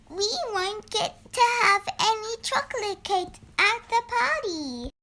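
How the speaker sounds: put-on mild childlike worry; worried little bear. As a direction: fret about missing the cake. Worried bear fears missing cake.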